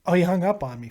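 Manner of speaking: ordinary talk, nothing marked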